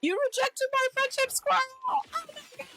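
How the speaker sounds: high mock-offended voice; mocking whiny accuser. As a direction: squeak the accusation like a wounded friend. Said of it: "being all like".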